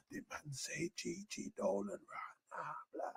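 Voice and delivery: slow, unvoiced whisper; hushed whisper on stream. Real, not acted. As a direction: murmur it secretly. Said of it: "I'm whispering on live stream".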